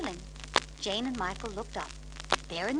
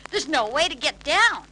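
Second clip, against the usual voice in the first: put-on higher, loud cheeky child; grinning boy; 4 semitones higher, 7 dB louder than usual. A narrator's reading. Michael joking to Uncle Albert, grinning. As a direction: say it with a grin. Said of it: "Michael grinned".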